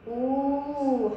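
"أو" held as a long, level oo; long waw madd. Shown as a demonstration. Teacher reading alif with waw madd, "أو" held long. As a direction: sustain the oo in "أو", very slow. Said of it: "حرف الألف مع مد الواو".

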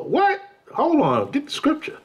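high, sharp mock disbelief; shocked demand. Member demanding scripture from the preacher, mock shocked. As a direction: squeal the question, then demand proof.

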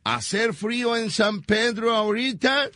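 sing-song pretend English question; mock English. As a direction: ask it like broken English. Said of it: "si hace frío en inglés".

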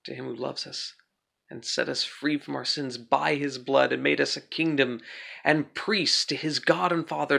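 ordinary talk

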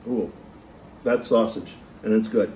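talking while eating pizza; muffled by food in mouth; mouth full, chewing. Real, not acted. Seen 0: Holds slice, lips pursed mid-chew while speaking, bites again at end.

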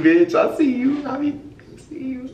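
ordinary talk, nothing marked

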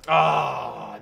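loud, drawn-out "ah"; frustrated cry. Real, not acted. Player just missing a shot, loud frustrated cry. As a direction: cry out in frustration at a near miss.

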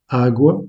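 "agua" with the stress on the first A, AH-gwa; Portuguese pronunciation. Shown as a demonstration. Teacher to French learners, "agua" stressed on the first A. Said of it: "L'accentuation est faite sur la première syllabe".

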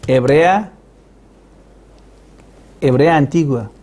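normal talking voice

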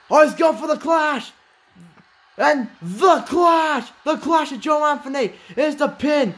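high, stretched hype call; excited build. Real, not acted. Commentator hyping the clash finisher, high excited call. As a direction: call the big move with rising hype.

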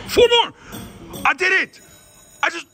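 very high, loud shout of triumph; jubilant cry. Real, not acted. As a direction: burst out in triumph. Wins jackpot.

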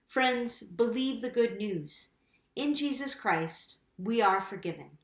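ordinary delivery

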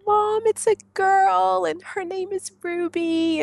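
high, loud and slow happy announcement; thrilled. Retold or imitated. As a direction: tell your mom the news. Telling her mom.

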